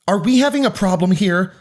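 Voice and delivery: high-pitched authoritative challenge; stern intervening adult. A narrator's reading. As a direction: break up a squabble with a pointed question. Volunteer breaking up a fight.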